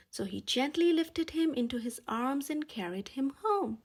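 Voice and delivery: ordinary delivery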